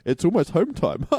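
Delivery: high-pitched, jokey quote ending in a laugh; imitated joking receptionist. Receptionist jokes to patient before home time, imitated as laughing and high. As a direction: play her having a bit of a laugh. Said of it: "she was having a bit of a laugh".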